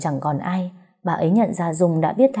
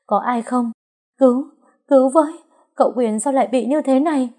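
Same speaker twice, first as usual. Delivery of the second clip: panicked scream; 7 semitones higher than usual. A narrator's reading. Wife screaming for help as her husband convulses, panicked. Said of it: "tiếng hét thất thanh của Trúc".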